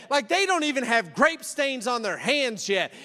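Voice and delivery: ordinary talk, nothing marked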